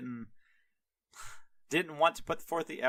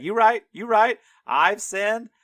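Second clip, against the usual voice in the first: high, loud, hammed-up admission; imitated over-eager confession; mock frantic concession; 10 semitones higher, 10 dB louder than usual. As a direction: give in at once, loudly. Rider caving in to the angel.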